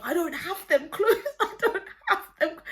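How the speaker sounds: high, loud mock protest with laughter; retold indignant denial. Acting brother denying it to his sister, loud laughing protest.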